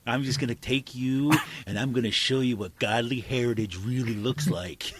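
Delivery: lightly voiced menacing politician; mock Saccone threat. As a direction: sneer it out as a cartoon bully lawmaker.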